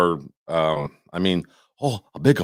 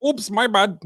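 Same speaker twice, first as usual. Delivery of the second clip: mock apology; 11 semitones higher than usual.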